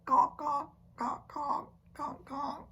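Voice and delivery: high, loud caw caw duckling cry fading down; duckling call. Retold or imitated. Reading a picture book, voicing the ducklings' call. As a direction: squawk like little ducklings, high and bright. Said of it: "They wiggle their tails and say".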